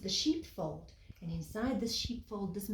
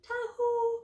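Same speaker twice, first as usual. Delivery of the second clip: high ringing call to the sheep; shepherd's call; 14 semitones higher than usual. Acted. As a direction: sing out to the flock. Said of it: "whenever he goes".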